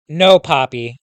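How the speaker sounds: loud call after him. A narrator's reading. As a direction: call out to stop him leaving. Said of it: "I called out".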